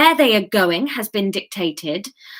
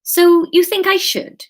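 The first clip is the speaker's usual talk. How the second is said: higher, louder character question; Kate's questioning line; 10 semitones higher, 5 dB louder than usual. Acted. Kate asks Graham for his opinion, higher questioning voice. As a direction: ask it brightly pitched up, as Kate. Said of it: "she asked".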